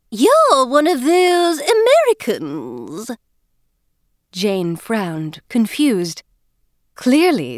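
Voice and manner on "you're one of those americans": high, overpronounced fake British voice with dropped Rs; a high, girlish put-on British act, words overpronounced; faux-British Eliza. Scene: Eliza cheerfully greets newcomer Jane, high fake-British girlish voice. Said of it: "she was attempting to sound British".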